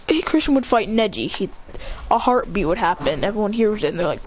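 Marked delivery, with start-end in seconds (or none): none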